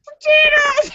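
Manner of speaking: very high squeal, repeated for laughs; yelled punchline. Acted. Joke teller reliving the blonde's punchline, high squealed yell. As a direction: squeal the word out in a silly voice. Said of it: "and she yelled".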